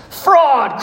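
high, drawn falling cry of outrage; crowd's cry. A narrator's reading. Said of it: "cried the maddened thousands".

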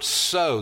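single out the word "so"; stress on so. Shown as a demonstration. Pointing the class to one word, "so" lifted high. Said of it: "The word".

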